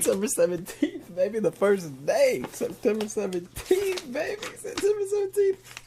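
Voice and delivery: high-pitched gleeful squealing; mock excitement. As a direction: squeal it as if celebrating. Excited mock celebration of the date.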